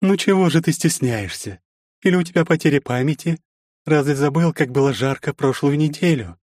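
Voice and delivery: high, teasing seductive voice; flirty stepmother. A narrator's reading. Anfisa seduces.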